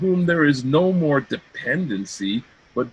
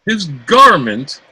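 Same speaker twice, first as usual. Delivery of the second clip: loud, stung repeat of the insult; indignant, offended outburst; outraged retort; 2 semitones higher, 8 dB louder than usual. Acted. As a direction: fling it back at her.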